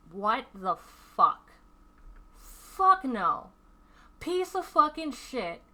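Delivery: high, loud, slow disbelieving outburst; outraged retold yell. Reliving horror at the creep near her nephew, high loud outrage. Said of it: "I'm like".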